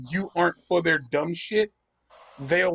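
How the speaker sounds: ordinary talk, nothing marked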